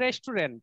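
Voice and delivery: "রেস্টুরেন্ট" as three-syllable res-tu-rent; Bengali pronunciation. Shown as a demonstration. Teacher repeating the habitual form, "রেস্টুরেন্ট" said res-tu-rent. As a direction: keep the middle "tu" in "রেস্টুরেন্ট". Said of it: "আমরা এটাকে কি বলি বাঙালিরা".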